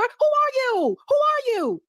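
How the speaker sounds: high, disbelieving repeated question; stunned by the voice change; incredulous. Real, not acted. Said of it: "You don't talk like that".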